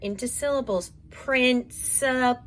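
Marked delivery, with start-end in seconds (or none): 1.1-2.4 slow, stretched sounding out; teacher stretching Principal out for kids. Shown as a demonstration. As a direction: drag each sound of Principal out slowly. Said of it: "I can really stretch out my sound".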